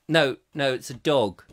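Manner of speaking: normal talking voice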